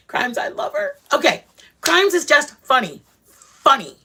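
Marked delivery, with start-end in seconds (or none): 0.1-1.0 gasp, then a much higher laughing gush. Real, not acted. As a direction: squeal with delight about her. Gushing over a favourite YouTuber.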